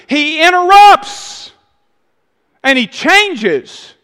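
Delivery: loud, rising sermon climax; preaching at full force. Acted. Preacher driving the point home to the congregation, loud and rising.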